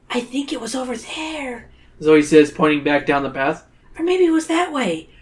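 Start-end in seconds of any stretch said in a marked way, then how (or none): 0.1-1.7 put-on high, soft innocent girl; Zoe. A narrator's reading. Zoe misdirecting the teacher, innocent high voice. Zoe's voice in the story. 4.0-5.1 high-pitched, innocent musing; Zoe. A narrator's reading. Zoe sending the group astray, innocent high voice. Zoe's voice in the story.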